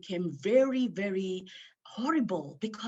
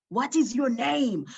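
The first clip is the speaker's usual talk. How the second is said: loud, higher demanding question; angry demand; 7 semitones higher, 8 dB louder than usual. Retold or imitated. Woman angrily demanding her own name from herself. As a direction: demand it angrily, pushing hard. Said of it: "And I got angry".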